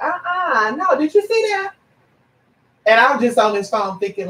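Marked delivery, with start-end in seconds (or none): 0.0-1.7 much higher, incredulous uh-uh; shocked disbelief. Retold or imitated. To the hater, high shocked disbelief. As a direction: gasp at the nerve of it. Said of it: "I was like".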